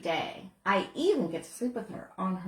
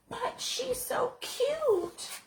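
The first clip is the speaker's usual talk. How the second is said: put-on high, slow, giggly little girl cooing over a puppy; little girl gushing. Girl at the shelter gushing over the dog, high and giggly. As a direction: coo like a smitten child, laughing. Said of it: "the girl said".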